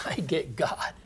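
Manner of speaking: soft unvoiced whisper with a laugh; whispered aside. Real, not acted. Preacher whispering his own joy to the congregation. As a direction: breathe it like a secret joy. Quiet personal confession in sermon.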